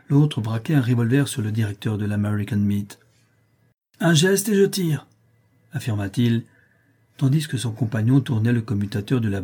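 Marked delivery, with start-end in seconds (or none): 4.0-5.1 high, urgent warning; armed threat. A narrator's reading. Workman aims a revolver at the director, high-pitched threat. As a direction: threaten him in a sharply raised pitch.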